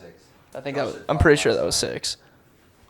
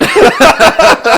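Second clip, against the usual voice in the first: high loud laughing fit; cracking up hard; burst of laughter; 13 dB louder than usual. Real, not acted. Cracking up at fake name.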